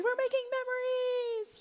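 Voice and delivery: very high, drawn-out mock cheeriness; over-bright parent putting on cheer; forced cheer. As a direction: sing it out to rally the kids. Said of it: "trying to be cheery with our boys".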